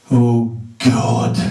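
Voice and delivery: flat, tired groan of a prayer; a mother sighing at God, mildly mocked; hint of a weary mother; mother's weary prayer. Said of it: "It went like this".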